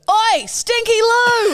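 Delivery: high, drawn-out tradie holler; retold yell. Two tradies heckling from their van, mock yell. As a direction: imitate yelling from a passing van. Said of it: "these two tradies yell out of their van".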